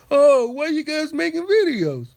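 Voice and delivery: high, loud mock whine. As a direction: whine it sarcastically. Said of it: "I don't say".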